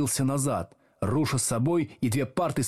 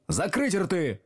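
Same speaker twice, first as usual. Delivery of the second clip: higher-pitched stern order; barked command; 7 semitones higher, slower than usual. A narrator's reading. Teacher silencing rowdy pupils, barking. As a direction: bark it at the class. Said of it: "гаркнул Слушкин".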